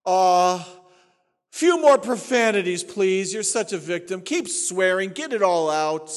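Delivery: hammed-up pitying coo, loud; sarcastically baby a hopeless believer; mock coddling.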